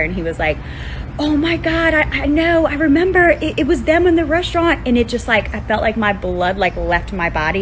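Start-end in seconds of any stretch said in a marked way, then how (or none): 1.2-4.9 high-pitched retold outcry without full volume; mock scream. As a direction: imitate someone shrieking a sudden realization. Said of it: "screaming bloody murder".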